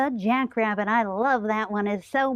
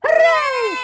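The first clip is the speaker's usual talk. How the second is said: higher, louder shouted cheer; a puppet leads three cheers, loud and high; 11 semitones higher, 6 dB louder than usual. Real, not acted. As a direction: belt it out like a cheerleader. Leading cheers for story time.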